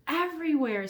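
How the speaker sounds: put-on high, loud boy voice; eager little boy. Elmer voiced in story.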